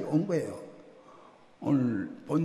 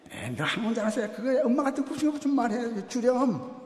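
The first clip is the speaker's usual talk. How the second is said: pleading mother; 8 semitones higher than usual. Retold or imitated. Mother pleading with her son.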